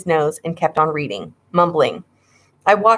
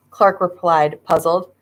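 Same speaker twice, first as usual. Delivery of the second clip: plain reading voice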